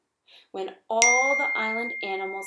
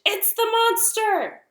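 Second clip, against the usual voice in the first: much higher, louder, slow screech; 13 semitones higher, 9 dB louder, slower than usual. A narrator's reading. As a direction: screech it out in alarm. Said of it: "fluttered up from his branch and screeched".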